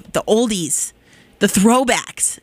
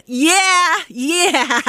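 excited yeahs; 7 semitones higher than usual. Real, not acted. DJ cheering as her favorite band is announced.

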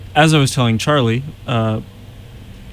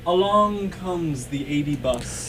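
soft, slow, distant; too quiet; 3 semitones higher, 7 dB quieter, slower than usual. Pretend. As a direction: murmur it from far off. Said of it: "You really gotta speak up".

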